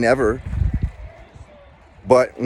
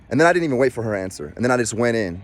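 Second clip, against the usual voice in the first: ordinary talk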